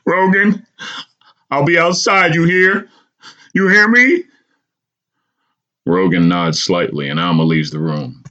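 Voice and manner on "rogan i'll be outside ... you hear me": much higher, pleading mother's voice; a mother urging her injured son from his hospital bed; tearful mother. A narrator's reading. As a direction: beg your son to hear you, near tears. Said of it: "Alma, sniffling".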